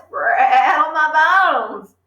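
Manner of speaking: slow, high, eerie bird croak; spooky parrot voice. Acted. Parrot repeating its trick for Matilda, spooky and slow. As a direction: draw it out like a ghostly parrot. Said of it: "a wonderful imitation of a spooky voice".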